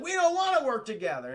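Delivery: lightly voiced sulky refusal; whiny refusal. The heroes protest to the president, mock whiny refusal.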